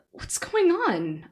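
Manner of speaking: high, very slow, pained cry; cried out. Acted. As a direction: cry out in shock and pain. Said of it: "I cried".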